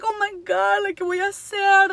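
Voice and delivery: high-pitched mock dismay; retold panic. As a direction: exclaim as if suddenly stranded. Retelling panic as car stops.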